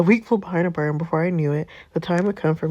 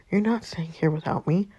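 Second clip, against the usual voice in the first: ordinary talk, nothing marked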